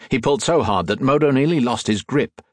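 plain reading voice

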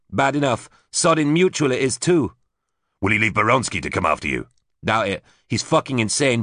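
a grim, defiant woman speaking up high; bitter and tough, set on revenge; tough woman's voice. A narrator's reading. Susie's voice, hard and bitter.

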